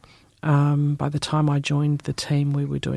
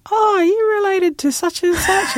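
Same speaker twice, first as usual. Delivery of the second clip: higher, quick prying auntie questions; eager older auntie; 5 dB louder than usual. Retold or imitated. Older auntie quizzing a lost young journalist, high eager voice. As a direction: press a young stranger for his family, high and eager. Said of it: "And then she goes".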